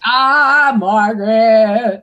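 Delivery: loud, drawn-out cheer of a name; big, stretched-out hail for a friend; fond shout-out. Real, not acted. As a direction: call out the name loud and long.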